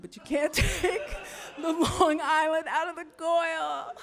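high, loud Long Island impression with a laugh; imitating a New York woman's Long Island accent, laughing through it. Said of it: "I can't do a really good impersonation".

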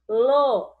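lo punched out loud; syllable called out. Shown as a demonstration. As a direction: call out lo as one clear beat.

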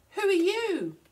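high, loud call from way up a tree. A narrator's reading. Koala to Wombat below the tree, calling out. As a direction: call down loudly, stretching the words. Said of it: "called Koala from way up in the tree".

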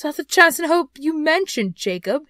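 high voice dropping off at the end; faltering. A narrator's reading. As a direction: falter anxiously through the question. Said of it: "he demanded in a faltering voice".

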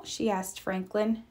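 plain reading voice

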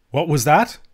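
taken aback, voice lifting; mighty changed voice. A narrator's reading. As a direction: ask it as if suddenly shaken. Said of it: "in a mighty changed voice".

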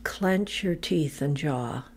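calm, measured meditation voice with long pauses; low-key, evenly paced body-scan narration; guided relaxation. Real, not acted. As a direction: guide them gently into letting go, phrase by phrase. Leads progressive relaxation meditation.

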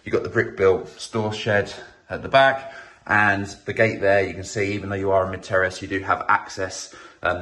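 ordinary talk

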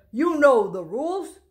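loud stern officer; policeman. Acted. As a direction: order the boy like a stern policeman. Policeman orders the boy.

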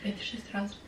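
whispered shock; hushed whisper. Real, not acted. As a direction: drop to a near whisper.

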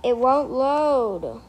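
stretched-out frustrated moan; whiny complaint. Real, not acted. Game stuck.

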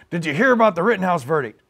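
usual speaking voice

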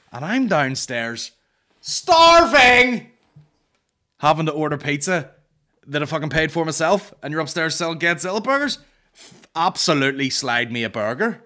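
loud, high outraged rant; comic rant. Acted. Comedian roaring at the promoters about a burger, outraged. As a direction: bellow it like a starving wronged man.

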